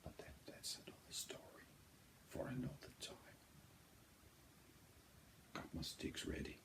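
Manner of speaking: soft whispered aside; hushed whisper before the music starts. Real, not acted. Hushed before the first listen.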